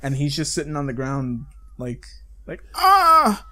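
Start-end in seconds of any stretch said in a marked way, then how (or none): 2.7-3.4 high mock scream of pain. Beaten man on the ground, hammed-up scream. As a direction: shriek like a man being beaten.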